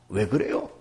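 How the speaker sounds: ordinary talk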